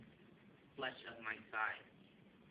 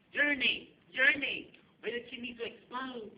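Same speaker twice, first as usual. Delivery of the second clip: full-voiced, high-pitched frantic shout; 13 semitones higher, 15 dB louder than usual. Rick shouting to passengers on a shaking plane, frantic shout. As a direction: yell it down the whole aisle. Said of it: "stands in the middle of the aisle and shouts".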